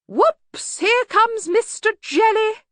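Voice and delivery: very high, slow, dotty sing-out; half-hearted rally. A narrator's reading. Bursar trying to rally, half-hearted high chirp. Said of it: "didn't sound as though his heart was in it".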